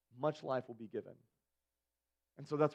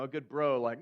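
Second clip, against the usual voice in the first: ordinary delivery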